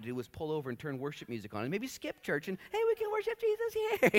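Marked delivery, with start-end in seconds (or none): 2.7-4.1 very high, airy; carefree Mary. Retold or imitated. Mary cheerfully suggesting worship in the car. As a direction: float the idea lightly. Mary wanting to skip church.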